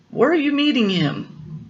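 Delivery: high-pitched storybook snake; high, put-on creature voice; questioning snake.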